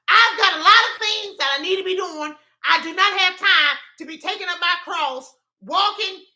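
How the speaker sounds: loud, high comic rant; indignant protest. Real, not acted. Speaker refusing three days dead, loud mock indignation. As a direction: protest like an overbooked, exasperated would-be martyr.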